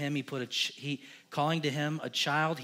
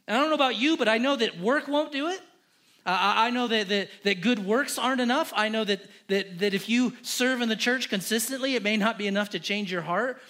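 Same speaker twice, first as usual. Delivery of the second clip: much higher, louder preaching; fervent preaching; 6 semitones higher, 5 dB louder than usual. Acted. Pastor urges the congregation to plead, preaching hard. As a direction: drive it home to the congregation.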